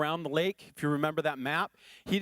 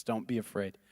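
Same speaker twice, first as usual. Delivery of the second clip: low, soft reassuring voice; calm them like a shepherd; hushed and lower, soothing; gentle Jesus; 7 semitones lower, 4 dB quieter than usual. Pretend. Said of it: "Those famous words that God passes".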